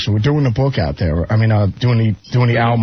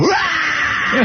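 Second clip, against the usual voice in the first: sudden high yell to spook someone; scare yell. Retold or imitated. Zach startling Ozzy at the woodshed, sharp high yell.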